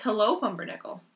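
normal talking voice

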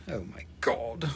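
whispered, low astonishment; breathless whispered exclamation; hushed disbelief. Pretend. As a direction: whisper it in mock awe. Mock awe at the rock.

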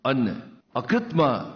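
emotional poem recital. Real, not acted. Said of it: "şiiri de kürsüden okudu, duygulandı".

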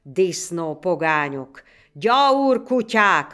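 slow, loud, drawn-out insults; hurled curses. A narrator's reading. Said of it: "röppentek az ég felé a szitkok".

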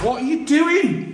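high, slow exasperated call. Real, not acted. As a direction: drag it out in disbelief. Exasperated at partner.

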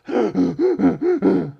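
high goofy donkey laugh; mock a dopey classmate's laugh. Schoolboy mocks his tormentor, with a goofy laugh. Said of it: "I'd mock his goofy laugh".

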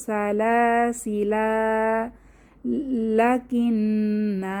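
very slow chanted recitation, final vowels held long; slow recitation pausing with a drawn-out alif; Quranic recitation. Shown as a demonstration. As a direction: chant it slowly, stretching each ending. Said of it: "কীভাবে পড়বো?".

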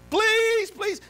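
very high, mock begging wail; whiny high-pitched plea; pleading. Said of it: "you ever pled for something".

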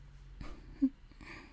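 breathy little chuckle under the breath; soft whispered laugh. Acted. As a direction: laugh almost silently. Listener dozing off, tender moment.

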